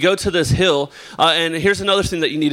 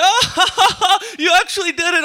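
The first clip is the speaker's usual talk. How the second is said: high, laughing mock of buddy; buddy cracking up at the fall; laughing friend; 14 semitones higher than usual. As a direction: squeal it through laughter. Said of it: "laughing hysterically".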